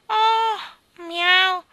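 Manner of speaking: high, loud mewing; cat meow. A narrator's reading. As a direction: mew twice like a cat, drawn out. (miagolio acuto e forte)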